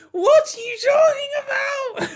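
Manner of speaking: high, loud disbelieving yell; incredulous shout. Real, not acted. Creator reacting to a tweet's analogy, incredulous shout.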